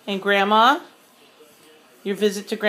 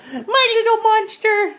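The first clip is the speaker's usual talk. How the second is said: high, slow cooing; 12 semitones higher than usual. Real, not acted. Mother cooing to her baby.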